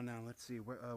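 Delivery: usual speaking voice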